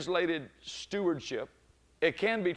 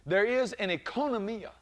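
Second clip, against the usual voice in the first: rising, louder, slowing proclamation; building sermon cadence; preaching build; 5 semitones higher, 6 dB louder than usual. Acted. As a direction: climb toward the shout.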